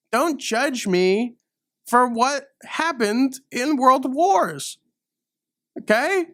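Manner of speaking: a mock-outraged American defending himself; indignant rant. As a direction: plead your innocence up in pitch. Heated rant at Europeans.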